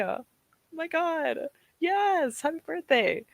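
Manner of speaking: high, bubbly birthday wishes; excited squeal. Real, not acted. Streamer wishing a viewer happy birthday, excited and high. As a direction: gush over the news.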